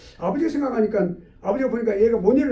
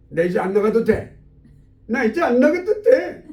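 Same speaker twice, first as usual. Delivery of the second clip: put-on defiant child; 2 semitones higher, 6 dB louder, slower than usual. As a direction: declare it boldly, stretching the words. Child defiantly answers the maid. (당당하게 버티는 아이처럼 큰 소리로)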